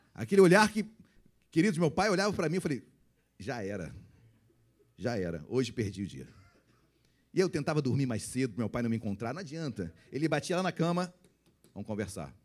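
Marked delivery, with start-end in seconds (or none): 3.4-6.3 low, soft mock doom; resigned. Child seeing the father's stern look, resigned. 11.7-12.4 stern father. Retold or imitated. Father summons child at bedtime.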